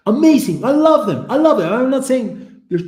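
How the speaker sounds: loud, high burst of affection. Real, not acted. Defending their loud parents to viewers, loud and emphatic. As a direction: gush it out at full volume. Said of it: "become louder and louder".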